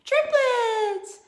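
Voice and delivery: high, loud hyped shout; excited reveal. Real, not acted. Teacher hyping up triplets for students. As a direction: sell the word like a prize.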